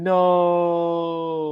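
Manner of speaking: long drawn-out "no"; mock anguished no. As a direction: wail it out in mock despair, held long. Dramatic mock cry at comic.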